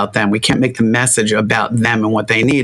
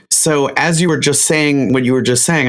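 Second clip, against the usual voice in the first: usual speaking voice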